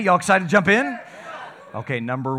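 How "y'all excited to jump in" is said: hyped rallying question, louder and higher; rally the crowd with energy; preacher pumping up the congregation; louder, excited call. Shown as a demonstration. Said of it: "I'm a little bit more excited for a preacher than that".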